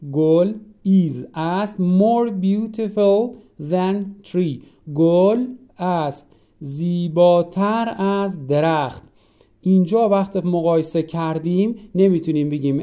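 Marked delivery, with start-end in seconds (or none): 0.1-9.0 slow and a bit louder, word by word; slow model sentence. Shown as a demonstration. Teacher to class, dictating slowly.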